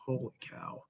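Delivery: barely voiced, floored; awed whisper. Telling the story of the suicide note, an awed whisper. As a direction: breathe it out in a stunned whisper.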